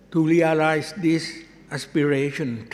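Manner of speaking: ordinary talk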